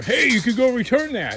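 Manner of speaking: high, loud mock voice; loud, high put-on quote; Bert from Scrap Farm. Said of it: "that Bert from Scrap Farm thinks that".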